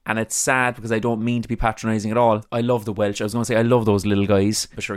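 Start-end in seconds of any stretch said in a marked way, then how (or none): none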